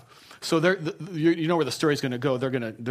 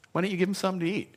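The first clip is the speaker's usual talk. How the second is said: fast, higher, sarcastic retort; cynical Jesus; 5 semitones higher, much faster than usual. Retold or imitated. Pastor voicing Jesus as a cynical boss to staff. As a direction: toss it back with mock sarcasm. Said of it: "I attach to it my cynicism".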